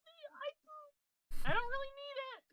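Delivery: high-pitched imagined reply; put-on high, hesitant protest; mock demurral. Acts out her would-be reply.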